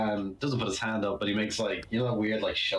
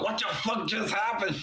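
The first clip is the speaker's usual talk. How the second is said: mock scream; 11 semitones higher than usual. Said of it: "screaming into his ear".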